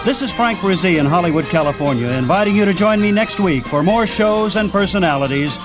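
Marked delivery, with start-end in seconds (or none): none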